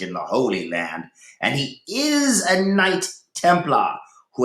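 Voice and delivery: lightly voiced disgusted lord. A narrator's reading. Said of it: "Cedric muttered to Rowena in disgust".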